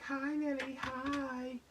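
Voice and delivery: put-on high, sweet little-girl greeting. Little rollerblading girl greets Lily in high chirpy voice. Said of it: "she's like".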